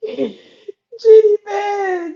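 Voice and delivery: high, loud laughing wail, stretched way out; laughing hard. Real, not acted. Vlogger cracking up at who got played, wailing with laughter.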